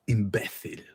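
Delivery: imbécil in a Spanish accent, theta for the c. Shown as a demonstration. Showing her co-host the Spanish word, lightly Spanish-accented. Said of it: "I like it in Spanish".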